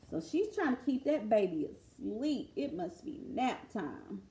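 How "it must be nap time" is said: ordinary delivery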